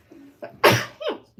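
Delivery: sudden loud sneeze; real sneeze. Real, not acted. Streamer sneezing mid-chat, real sneeze. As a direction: let out a big, explosive sneeze. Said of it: "I'm gonna sneeze".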